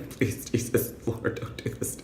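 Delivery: hushed, pleading whisper; whispered desperate prayer. Retold or imitated. Freaking out in the cab, whispered plea to God.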